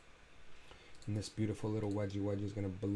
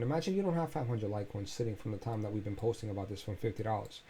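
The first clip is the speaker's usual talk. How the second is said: ordinary talk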